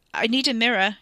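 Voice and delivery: urgent plea through a tight throat; strained croak. A narrator's reading. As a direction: croak it out, struggling for breath. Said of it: "he croaked, struggling to breathe".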